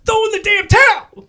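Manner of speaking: very high loud mock scream; hammed-up trainer shriek; Tony scream. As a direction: screech it desperately. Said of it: "And then finally".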